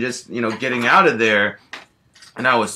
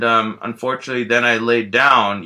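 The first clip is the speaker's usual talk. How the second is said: usual speaking voice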